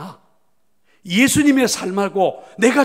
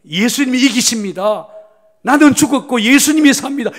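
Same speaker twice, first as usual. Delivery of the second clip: much higher and louder, a ringing declaration; model answer; 7 semitones higher, 11 dB louder than usual. Shown as a demonstration. Pastor gives the congregation the right answer, ringing proclamation. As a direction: shout out the right answer like a confession of faith. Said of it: "정답은 뭐가 돼야 돼요?".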